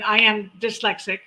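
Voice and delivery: ordinary delivery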